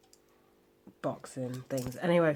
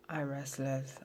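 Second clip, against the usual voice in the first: ordinary delivery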